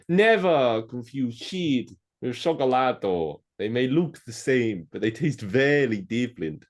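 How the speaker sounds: slow, theatrical mock Italian delivery; toy maker's Italian accent. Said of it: "Don't mix".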